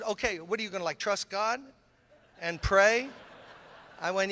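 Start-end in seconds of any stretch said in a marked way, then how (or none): 0.0-3.1 sceptical caller. Retold or imitated. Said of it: "He said".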